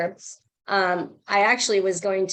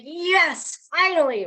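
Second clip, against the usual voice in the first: higher and louder, triumphant yes; excited cheer; 8 semitones higher, 5 dB louder, slower than usual. Retold or imitated. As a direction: cheer as if relieved at last. Retold joy at the blown-up messages.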